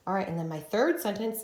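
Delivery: ordinary delivery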